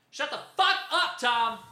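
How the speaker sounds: high, thin holler from the back; high-pitched heckle from far off; mock distant yell. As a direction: pretend to yell across a cafeteria, held back in volume. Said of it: "someone in the back of the cafeteria to go".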